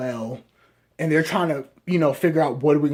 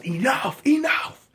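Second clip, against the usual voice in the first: mock shout, the second one much higher; yelling over the arguing room, pitched up; retold yell; 8 semitones higher, slower than usual. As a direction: imitate her yelling at the team to stop. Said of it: "she just yells".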